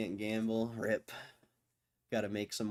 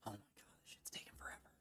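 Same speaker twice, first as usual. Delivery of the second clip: whispered grumble under the breath; impatient whisper; 20 dB quieter, much faster than usual. Real, not acted. Bored streamer waiting for the summit, impatient whisper. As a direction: complain in a barely audible whisper.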